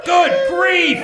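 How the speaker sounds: loud, high shout of shock. Acted. Townsman sees the giant baby, shocked shout. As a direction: gasp it out at the sight.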